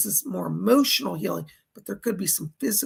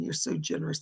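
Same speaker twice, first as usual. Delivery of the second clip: ordinary talk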